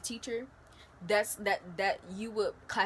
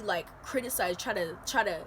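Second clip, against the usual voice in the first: usual speaking voice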